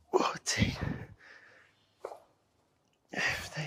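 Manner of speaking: breathless whispered counting. Real, not acted. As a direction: whisper the count through effort. Counting reps under heavy load.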